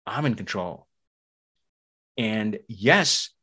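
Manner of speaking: normal talking voice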